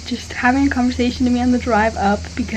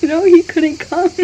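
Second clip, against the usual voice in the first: laughing; 5 semitones higher, 8 dB louder than usual. Real, not acted. Laughing awkwardly about partner.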